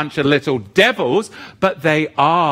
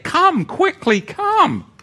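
fervent plea; slower than usual. Acted. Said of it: "yes, I can say it".

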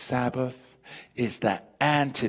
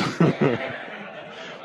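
breathy laugh; 3 semitones higher than usual. Real, not acted. Laughing at own joke.

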